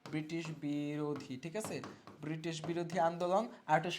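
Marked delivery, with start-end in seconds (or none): 0.0-1.3 slow, low, softer teacher's dictation; stretched out for note-takers. Shown as a demonstration. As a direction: draw the term out syllable by syllable. Teacher spelling out the term slowly.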